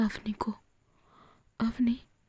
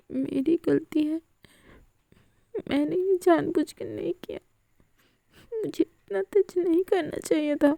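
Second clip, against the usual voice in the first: tearful apology; 6 semitones higher than usual. Acted. Avni crying, apologising.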